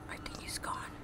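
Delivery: hushed unvoiced whisper. Real, not acted. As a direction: whisper so it can't hear. Hiding from the monster.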